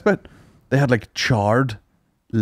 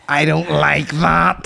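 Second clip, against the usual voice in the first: mocking sister; 4 semitones higher, much slower than usual.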